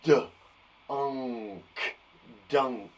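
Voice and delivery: D, unk split apart, then dunk blended; segmenting sounds. Shown as a demonstration. Teacher modelling segmenting dunk for the class. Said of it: "I'll go first, then you go".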